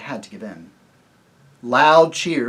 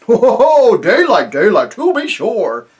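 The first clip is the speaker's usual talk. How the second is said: loud, high ho ho ho chuckle; the chief laughs off the girl's question; hearty chief laughter; 8 semitones higher, 11 dB louder, slower than usual. Acted.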